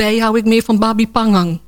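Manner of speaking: ordinary talk, nothing marked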